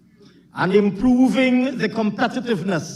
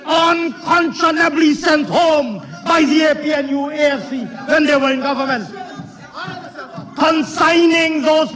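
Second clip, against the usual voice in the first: raised, emphatic political declaiming; forceful parliamentary oratory; 5 semitones higher, 3 dB louder than usual. Real, not acted. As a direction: project each phrase across a noisy chamber.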